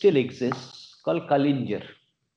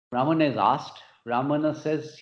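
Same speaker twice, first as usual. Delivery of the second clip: ordinary talk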